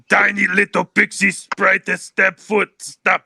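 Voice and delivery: loud, slow ogre speech. Acted. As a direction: boom it out slowly as an annoyed ogre. Game master voices the stabbed ogre.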